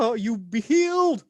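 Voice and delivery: high, wailed proclamation; hammed-up revival cry; mock faith healer. Helper runs down to the patient, hammed-up healing proclamation.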